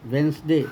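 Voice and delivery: "वेंसडे" in two parts, wens-day; silent D. Shown as a demonstration. Teacher correcting what everyone says, Wednesday with silent D. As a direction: say "वेंसडे" with the first D dropped. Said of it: "डी का उच्चारण नहीं होता है".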